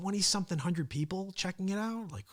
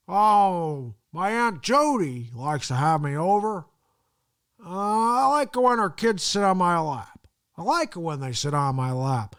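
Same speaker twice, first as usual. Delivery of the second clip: put-on slow, rambling old-politician voice, louder; Joe Biden impression; 4 semitones higher, 8 dB louder than usual. Vlogger taking a viewer request, slow dragging Biden voice. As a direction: drag out the words like a doddering Biden. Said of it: "I want you to do Joe Biden".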